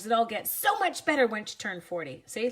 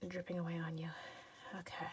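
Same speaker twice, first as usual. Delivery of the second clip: whispered asides; 3 semitones lower, 11 dB quieter than usual. Real, not acted. Away from mic, whispering.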